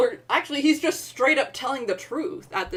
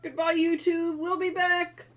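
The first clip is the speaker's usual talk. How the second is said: high, sustained farewell to viewers; chirpy stream goodbye, pitched way up; playful sign-off; 9 semitones higher than usual. Real, not acted. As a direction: call goodbye high and cheery. Signing off to the stream.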